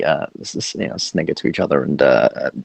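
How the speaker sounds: ordinary talk, nothing marked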